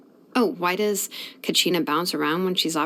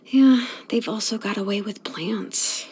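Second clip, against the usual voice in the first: whispered aside; 2 semitones higher than usual. Real, not acted. Jealous engineer whispering about the tribe's way with plants. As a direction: let it slip out in a whisper.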